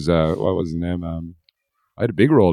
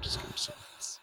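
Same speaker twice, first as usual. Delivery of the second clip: sulky mutter; 21 dB quieter, faster than usual. Retold or imitated. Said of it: "And I was like".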